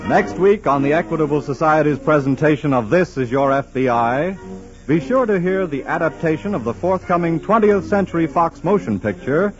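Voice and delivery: usual speaking voice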